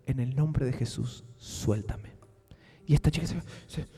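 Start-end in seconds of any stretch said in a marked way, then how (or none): 0.1-2.1 mock solemn rebuke. Rebuking in Jesus' name.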